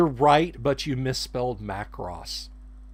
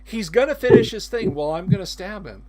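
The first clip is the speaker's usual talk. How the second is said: higher, louder fed-up game master; exasperated DM; 7 semitones higher, 7 dB louder than usual. Retold or imitated. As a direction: sigh it out, done with the players. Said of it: "the D M's looking exasperated".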